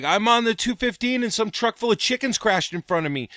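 high flustered excuse voice; Doug's excuse. Retold or imitated. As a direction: play the flustered guy pleading his case.

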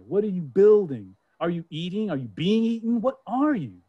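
urgent questions. Acted. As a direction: call down into the ground, pressing.

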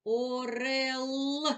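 Орел stretched long, each sound held; drawn-out word. Shown as a demonstration. Teacher finishing the sound model. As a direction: pull Орел out slowly. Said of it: "Голосний позначаємо кружечком".

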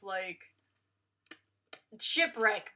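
ordinary delivery

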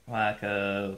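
ordinary talk, nothing marked

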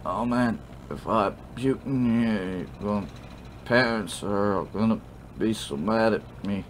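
put-on slurred, rambling drunk; sloppy drunk apology. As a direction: stumble tipsily and drag out the last words. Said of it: "what it sounds like when you're drunk".

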